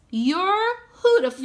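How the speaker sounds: a hint of a sweet owl voice; owl's note. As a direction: read it as the little owl. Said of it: "Mitty says".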